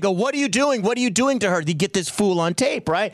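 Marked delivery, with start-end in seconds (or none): 0.1-1.6 higher-pitched mock confrontation; acted-out accusing questions, pitched up; confronting bystander. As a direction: imitate an indignant onlooker challenging someone. Acts out bystanders confronting the man.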